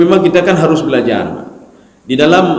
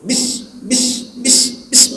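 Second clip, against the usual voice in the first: whispered stammering bis bis; imitating a worshipper stuck on "bis"; mock stuttered bismillah; 5 semitones higher, 6 dB quieter, much slower than usual. Shown as a demonstration. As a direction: stumble on "bis" in a hushed whisper. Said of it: "betul bismillah".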